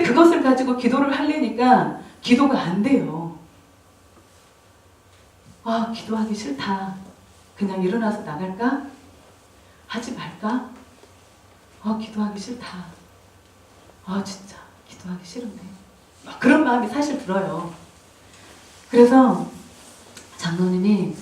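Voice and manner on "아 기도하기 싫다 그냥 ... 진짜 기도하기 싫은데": reluctant inner grumble. Retold or imitated. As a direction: quietly grumble to yourself, fading almost to a whisper. Inner reluctance to pray, acted. (기도하기 싫어 작게 혼잣말로 투덜대듯)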